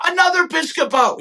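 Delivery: high, gleeful Hanks exclamation; Tom Hanks delighted. Retold or imitated. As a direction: greet the daughter like Hanks, tickled. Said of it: "He goes like that".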